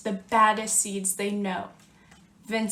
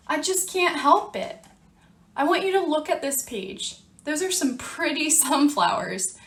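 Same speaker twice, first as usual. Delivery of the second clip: normal talking voice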